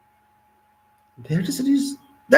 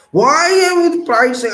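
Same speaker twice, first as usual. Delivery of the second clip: impassioned; 11 semitones higher, 6 dB louder than usual. Real, not acted. Impassioned rhetorical speech.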